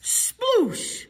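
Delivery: loud, high cartoon sploosh; a hammed-up mud splash; big splash sound. A narrator's reading. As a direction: shout the splash for the kids. Friends fall into mud.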